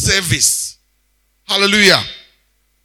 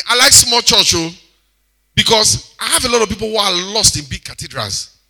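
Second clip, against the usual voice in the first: usual speaking voice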